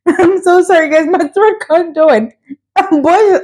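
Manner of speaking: high-pitched, laughing through the words; real laughing fit. Real, not acted. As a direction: try to talk while cracking up. Breaking up laughing, apologizing.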